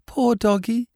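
high, lightly voiced little boy near tears; a small child pitying the dog; tearful small boy. A narrator's reading. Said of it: "He was crying".

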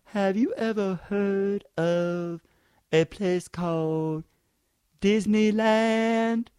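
slow, drawn-out dopey voice; mocking the caller. Comedian quotes his Disneyland question, slow mock voice. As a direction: stretch every word like a slow guy. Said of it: "this is what he said".

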